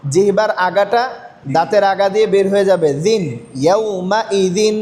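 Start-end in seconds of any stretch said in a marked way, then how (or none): 3.6-4.8 teacher models ইয়াউমাইদিন slowly for the class; slow Quranic recitation. Shown as a demonstration. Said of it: "পাতলা হবে".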